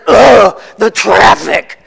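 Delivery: put-on fed-up toddler sigh. Pastor imitating his toddler son's weary sigh. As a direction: groan it like a tired little commuter. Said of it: "sighs a big sigh and says".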